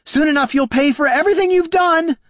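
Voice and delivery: high, furious shouted threat; hollering. Acted. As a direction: yell vengeance after them, piling on names. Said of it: "she hollered after them".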